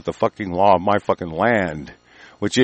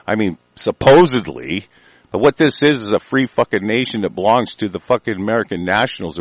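ordinary talk

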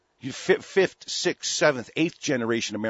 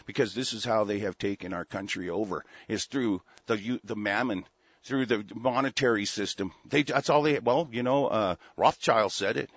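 ordinary delivery

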